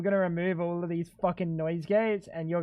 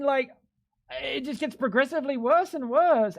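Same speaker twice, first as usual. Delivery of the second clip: ordinary talk, nothing marked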